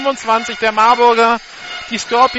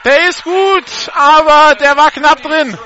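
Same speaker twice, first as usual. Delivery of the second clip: much higher, louder kick call; excited play-by-play; 7 semitones higher, 7 dB louder, slower than usual. Real, not acted. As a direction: call the deciding kick, thrilled. Live call of the deciding kick.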